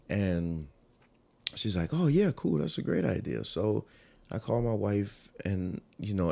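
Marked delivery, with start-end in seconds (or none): 1.9-3.4 high-pitched, upbeat friend; retold delight, pitched up; enthusiastic agreement. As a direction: imitate a friend jumping at the plan. Acts out friend agreeing to plan.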